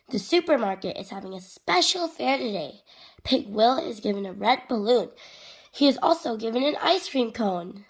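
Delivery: lively, projected picture-book reading; children's story narration. A narrator's reading. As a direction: read it animatedly to little kids. Reads storybook aloud to children.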